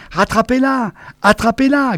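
high yelping command; high shrill-free yapping order. A narrator's reading. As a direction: yelp it in a panic. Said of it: "glapissait Namur".

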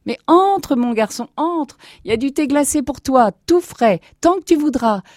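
high-pitched, excited invitation; eager welcome. Acted. Grandparents inviting their grandson indoors, eager welcome.

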